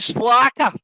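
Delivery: loud delivery. Shown as a demonstration. Told to say it right to Laura, loud slacker. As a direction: hit slacker loud and high. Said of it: "make sure you say that right".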